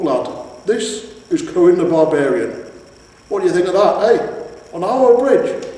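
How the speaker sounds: loud, rising, excited father troll; proud troll father. A narrator's reading. Troll father showing off his son, excited and loud. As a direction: show off your lad to the hero.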